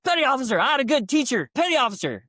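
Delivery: high, loud military answer; mock crisp recruit reply. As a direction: snap it out like a proud recruit. Recruit answering officer smartly.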